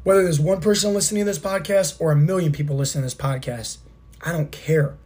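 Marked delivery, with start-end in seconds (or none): none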